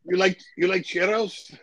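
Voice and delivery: hammed-up Mexican accent. Playing a Mexican alien.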